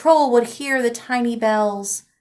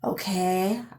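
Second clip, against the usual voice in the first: sighing; 4 semitones lower, 8 dB quieter than usual. Acted. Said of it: "I said, sighing".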